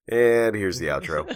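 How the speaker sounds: hammed-up radio host line; mock announcer. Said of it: "and just be like".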